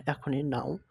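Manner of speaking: normal talking voice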